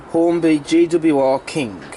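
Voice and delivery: usual speaking voice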